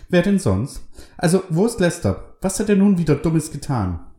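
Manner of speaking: high, lively woman's voice; Carol's voice. A narrator's reading. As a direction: pitch up for the pious wife.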